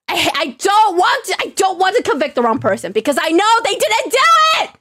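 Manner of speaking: high, whining protest; upset protest. Real, not acted. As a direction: plead it high, frustrated. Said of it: "I'm so upset".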